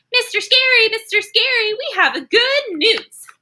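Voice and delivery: high, excited calling out; eager twins. Acted. Twins call out to Mister Scary, high and excited. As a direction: squeal it like an eager little girl.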